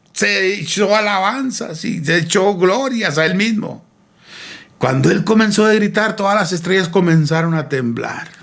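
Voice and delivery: high preaching cry, easing toward the end; impassioned storytelling. Acted. Preacher tells it at full pitch. (relato ferviente en tono alto)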